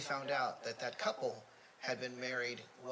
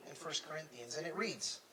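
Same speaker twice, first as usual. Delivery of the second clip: ordinary talk, nothing marked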